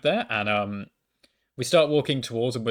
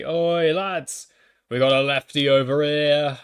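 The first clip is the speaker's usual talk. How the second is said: loud, higher imitation of a lad's holler; heckler calling out; 4 semitones higher, 6 dB louder, slower than usual. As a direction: call your mates over, mockingly. Stranger calling out behind him.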